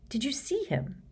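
high, hushed horrified question; taken aback, high and soft. A narrator's reading. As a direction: ask in mild horror, high and soft. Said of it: "looking horrified".

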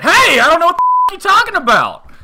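very high, loud, fast shout in another voice; yelling back. Retold or imitated. Stranger yelling back at the teller, high shout. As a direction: shout him down, outraged.

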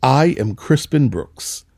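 ordinary talk, nothing marked

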